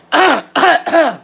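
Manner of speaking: loud, repeated real coughs; sudden loud hacking; coughing fit. Real, not acted. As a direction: cough hard several times in a row.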